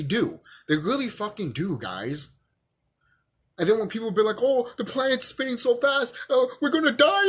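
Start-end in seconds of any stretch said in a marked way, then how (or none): 4.4-7.3 high-pitched mock alarm; put-on doom-struck worry; mock panic. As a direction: imitate people fretting in a raised, wailing pitch. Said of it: "Why are you scared?".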